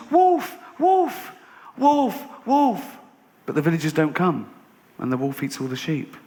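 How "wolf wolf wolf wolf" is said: very high repeated shout; frantic alarm cry. Acted. Boy calling villagers as real wolf comes, frantic. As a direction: cry for help in acted panic.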